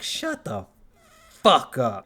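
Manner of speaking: annoyed retort. Retold or imitated. Mocking a movie character's line, annoyed loud retort. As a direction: tell the character off, fed up.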